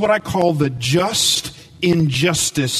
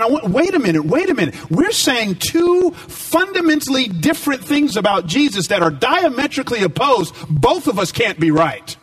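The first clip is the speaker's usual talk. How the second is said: emphatic protest; 7 semitones higher than usual. Retold or imitated. Retold protest to friend.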